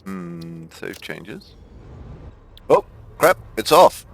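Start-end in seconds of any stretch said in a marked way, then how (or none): 2.7-4.1 loud, high alarmed cry; surprised shout. Real, not acted. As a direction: blurt it out in sudden alarm. Train leaves too early.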